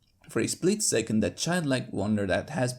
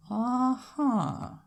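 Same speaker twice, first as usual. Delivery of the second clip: mumbled Uh-huh; 11 semitones higher, 4 dB louder than usual. Acted. Applejack doubting the doctor, Southern mumble. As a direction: mutter doubtfully to herself. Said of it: "she mumbles to herself".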